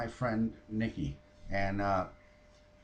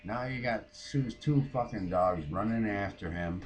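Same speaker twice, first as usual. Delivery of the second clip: normal talking voice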